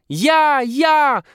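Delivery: put-on very high, very loud kids' cry; deafening shout. A narrator's reading. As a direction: yell it at the top of the lungs. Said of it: "оглушительно кричат дети".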